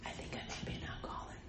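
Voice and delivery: quick, hushed whisper; whispered confession. Retold or imitated. Telling her sponsor she might be alcoholic, whispered. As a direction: whisper it fast like a shameful secret. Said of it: "Why are you whispering".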